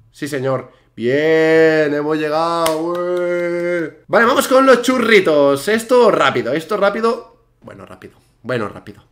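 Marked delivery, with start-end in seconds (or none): 0.9-3.9 drawn-out celebration. Real, not acted. Celebrates reaching the checkpoint. 4.1-7.4 high, loud and eager; pumped-up gamer rallying himself; excited rallying. Real, not acted. Eagerly starts the next stage.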